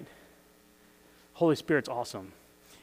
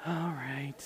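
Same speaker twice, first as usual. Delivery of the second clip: bored, flat mumble, much softer; unexcited shrug; 13 dB quieter than usual. Retold or imitated. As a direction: say it like a kid who doesn't care. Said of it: "They don't go".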